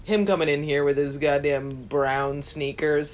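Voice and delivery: normal talking voice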